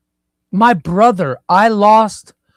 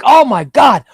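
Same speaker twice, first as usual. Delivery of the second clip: loud, high, exasperated shout; a frustrated outburst at yourself; angry yell; 6 semitones higher, 8 dB louder than usual. Retold or imitated. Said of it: "I yell. I'm like".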